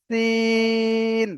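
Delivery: সিন with a thin স like a tyre hiss, vowel held; long hissing sin. Shown as a demonstration. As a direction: hiss the স then draw out সি. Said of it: "সেই উচ্চারণটা এখানে আসবে". (সাইকেলের চাকার স-এর মতো সিন)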